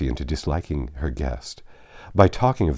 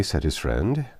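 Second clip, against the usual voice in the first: ordinary delivery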